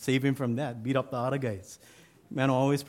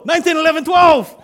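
very high, loud, building to a bellow; Mano, cocky now, yelling his hat size to the sergeant; cocky shout; 16 dB louder than usual. Retold or imitated. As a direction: shout it out proud and sure. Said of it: "getting a little bit cocky".

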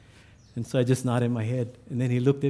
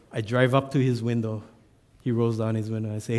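normal talking voice

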